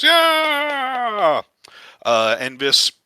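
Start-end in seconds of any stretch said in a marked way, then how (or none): 0.0-1.4 high, long-held cheer; crowd cheer. Acted. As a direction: imitate a crowd cheering with one long cry. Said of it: "And the crowd cheers".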